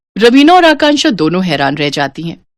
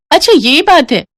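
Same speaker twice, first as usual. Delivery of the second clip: much higher, dawning oh; realization; 9 semitones higher than usual. Acted. Bride understanding mother-in-law's excuse, high dawning tone. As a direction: sound it dawning on you.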